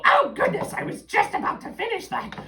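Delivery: put-on high, loud cackling old witch; shrill old witch voice at the door. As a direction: screech it like an old hag. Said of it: "this voice goes".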